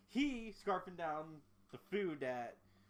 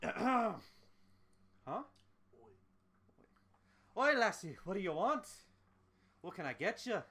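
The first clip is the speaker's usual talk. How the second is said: Scottish-Irish barkeep, pitched up and louder at the start; Scottish-Irish accent; 5 semitones higher, 5 dB louder than usual. Acted. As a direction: greet her in a rough attempt at Scottish-Irish. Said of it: "Scottish-Irish accent".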